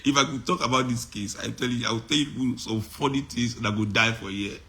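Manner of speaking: ordinary talk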